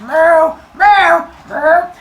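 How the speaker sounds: loud, very high moos; a dragged cartoon cow complaining; protesting cow. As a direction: moo in high-pitched protest.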